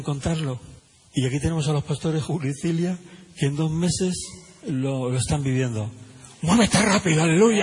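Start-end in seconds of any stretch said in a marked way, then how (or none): 6.4-7.6 rousing shout. Real, not acted. Preacher's closing exclamation.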